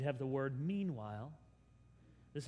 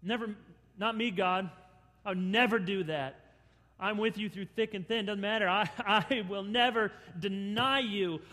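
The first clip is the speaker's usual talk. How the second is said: loud earnest boast; pledging to God; 4 semitones higher, 6 dB louder than usual. Pretend. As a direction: promise God confidently. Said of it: "those sort of promises to God".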